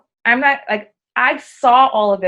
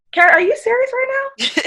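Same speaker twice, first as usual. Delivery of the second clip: incredulous friend. Retold or imitated. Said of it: "And they're like".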